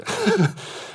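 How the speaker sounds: short high, loud laugh. Real, not acted. Teacher amused at his own question about complainers, laughing. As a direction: burst out laughing briefly.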